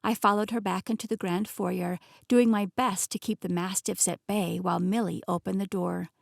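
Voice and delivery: plain reading voice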